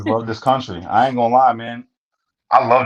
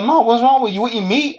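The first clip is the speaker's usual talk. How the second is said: high, far-off mock voice of the Salvadoran inmate in broken English; imitating his accent; 13 semitones higher than usual. Retelling a cellmate's words to the host, high mock accent. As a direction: pitch it up high and foreign, like the little homie. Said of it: "that's how he talk".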